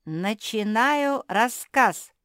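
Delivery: high, slow, loud proclamation; loud announcement. A narrator's reading. Basil opens his tale to everyone, announcing loudly. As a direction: announce it grandly, drawing it out. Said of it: "громко оповестил Базиль".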